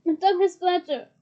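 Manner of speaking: strained croaking elf. Acted. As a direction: croak the name out. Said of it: "croaked the elf".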